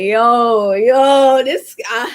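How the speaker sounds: a spooked, choked-up call on seeing someone; jittery and spooked, voice catching; nervous outburst. Retold or imitated. Nervous at dark crossroads.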